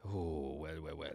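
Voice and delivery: a low, hushed French judge; French woman accent. Pretend. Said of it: "You're a French woman that is a judge in a cat competition".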